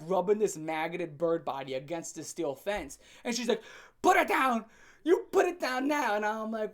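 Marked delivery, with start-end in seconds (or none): 4.0-6.2 higher, louder stern demand; angry order. Retold or imitated. Girlfriend ordering him to drop the bird, loud. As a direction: snap it like a furious girlfriend.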